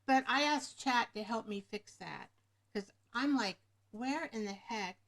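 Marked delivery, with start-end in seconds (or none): none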